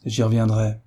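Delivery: usual speaking voice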